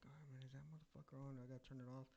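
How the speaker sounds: hushed, half-whispered self-talk; retold quiet worried mutter; muttering to himself. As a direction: say it under your breath. Muttering to himself in dark office.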